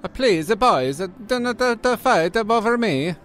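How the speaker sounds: very high, pleading mock-Italian woman; put-on Italian accent. Fake Italian woman begs the boys not to fight, put-on Italian accent. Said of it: "the Italian woman I'm pretending to be".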